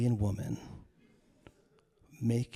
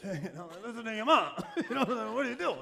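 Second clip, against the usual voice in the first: high, fast words through laughter; gleefully egging mom on, laughing; laughing agreement; 10 semitones higher, much faster than usual. Acted. Closet eavesdropper siding with the mom, laughing.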